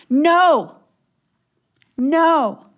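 loud, high NO with a pause after each; emphatic refusal. Acted. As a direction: lay down God's firm prohibition. Voicing God's emphatic refusal.

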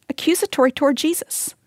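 normal talking voice

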